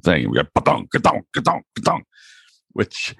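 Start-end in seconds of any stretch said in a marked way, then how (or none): 0.5-2.0 high-pitched rhythmic ka-dong noise; imitated mechanical clunking. Recalling the machine paying out money, mimicked mechanical clanking. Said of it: "it had this very mechanical".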